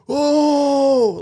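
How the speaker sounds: long, high, loud scream; imitated crowd scream. Passengers seeing the bigger fire, loud imitated scream. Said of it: "like, scream".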